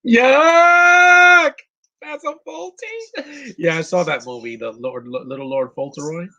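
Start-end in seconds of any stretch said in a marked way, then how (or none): 0.1-1.5 high, loud yell of disgust; shrieked yuck; disgusted shout. Real, not acted. Disgusted outburst at screen. 2.0-3.5 high, slow put-on squeak; a silly squeaky voice; mock funny voice. Odd voiced question.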